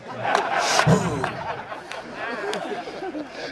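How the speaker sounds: long, rumbling huff; exaggerated drawn-out puffing; breathing hard. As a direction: blow out a long heavy lineman's breath. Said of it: "he breathing hard like".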